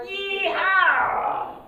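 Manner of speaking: high, loud cowboy whoop; hammed-up cowboy yeehaw. Shown as a demonstration. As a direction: holler it like a rodeo cowboy. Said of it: "you go".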